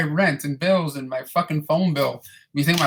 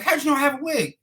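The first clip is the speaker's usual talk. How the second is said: much higher put-on voice for the lady; lady's surprised question; 10 semitones higher than usual. Boss lady asking about her wig, in a high put-on voice. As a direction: ask it amused and surprised. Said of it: "she's like".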